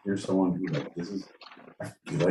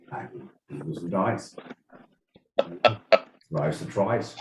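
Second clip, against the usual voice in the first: a hint of a mumbled Australian accent, softer; mock Aussie; 3 semitones lower, 4 dB quieter than usual. Mumbling a lightly Australian rhyme to show his accent.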